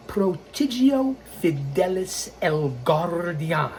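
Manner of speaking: slowly drawn-out Spanish pronunciation; Spanish-accented flourish. Shown as a demonstration. Host repeats his account name for fans, slow Spanish accent. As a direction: roll the r, Spanish style, unhurried.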